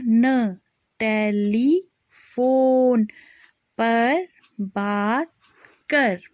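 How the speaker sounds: drawn-out syllables, each sounded clearly; slow spelling drill. Shown as a demonstration. Teacher spelling out a sentence for children, slow and clear. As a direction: spell it out slowly for young learners.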